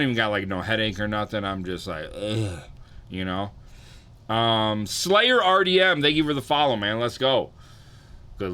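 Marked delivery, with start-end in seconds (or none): 4.9-7.5 loud, high shout-out to a follower; hype shout-out. Real, not acted.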